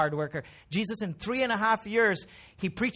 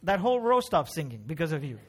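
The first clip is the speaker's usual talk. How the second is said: ordinary talk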